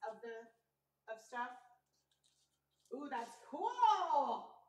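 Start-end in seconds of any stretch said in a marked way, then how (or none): 2.9-4.5 delighted ooh. Real, not acted. Admiring her painting.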